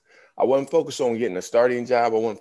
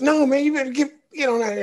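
hurried coach; 5 dB louder, faster than usual. Retold or imitated. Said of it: "He goes".

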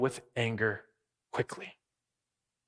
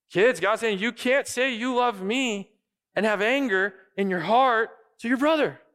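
high, louder earnest plea; God pleading; 9 semitones higher, 5 dB louder than usual. Acted. Preacher voicing God to the congregation, high earnest plea. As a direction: plead with the whole heart.

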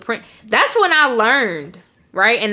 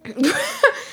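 laugh; 3 dB quieter than usual. Real, not acted. Laughing at the story.

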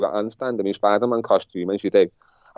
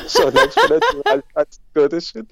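talking through laughter, pitched up; laughing; 6 semitones higher than usual. Real, not acted. Telling the host about reaching Goodison, laughing as he talks. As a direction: let a laugh run through the words.

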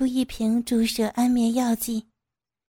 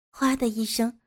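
ordinary delivery, nothing marked

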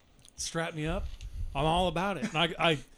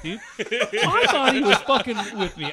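ordinary talk, nothing marked

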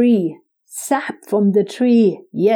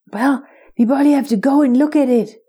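fast, higher squirrel chatter; quick squirrel voice; 4 semitones higher, much faster than usual. Acted. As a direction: rattle it off like an eager squirrel.

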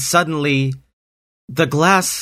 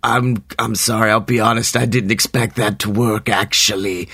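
snake voice. Acted. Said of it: "the snake responded".

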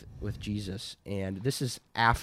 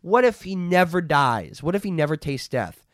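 raised, louder, emphatic dismissal; acted rebuke; 6 semitones higher, 7 dB louder than usual. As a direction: brush off the question forcefully as Jesus. Acting Jesus dismissing Peter's question.

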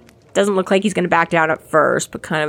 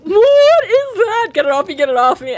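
high, panicked shrieking; terrified kid yelling to get it off; kid freaking out; 10 semitones higher, 3 dB louder than usual. Retold or imitated. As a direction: shriek it like something grabbed your ankle. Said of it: "freaks out".

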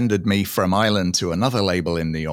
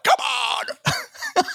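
mock rallying cry. Player with more balls than technique, mock rallying cry. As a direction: cry it out high, all nerve. Acting out his bold attitude.

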